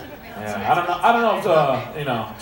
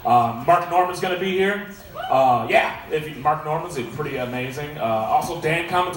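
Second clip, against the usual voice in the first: ordinary talk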